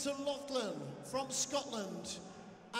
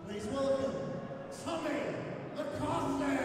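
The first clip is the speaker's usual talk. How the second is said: booming ring announcer build to the name. Real, not acted. Ring announcer to the arena crowd, building and booming.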